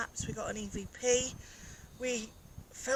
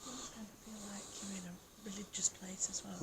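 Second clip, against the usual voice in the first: breathy whisper, no voice; 3 semitones lower, 7 dB quieter than usual. Real, not acted. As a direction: hush it like you're in church. Hushed talk inside ruined church.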